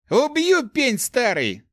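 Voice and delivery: high, loud threat; furious yell. A narrator's reading. Thief threatening the old mage who hit him, yelling. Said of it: "заорал он".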